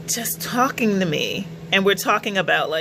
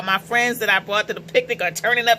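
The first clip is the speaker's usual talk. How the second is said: ordinary delivery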